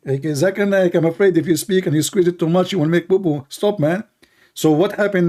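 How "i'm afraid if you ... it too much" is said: ordinary delivery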